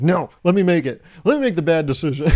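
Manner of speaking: hammed-up indignant demand; mock angry protest. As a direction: protest like a thwarted online shopper. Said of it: "and still you're angry".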